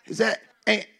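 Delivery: ordinary delivery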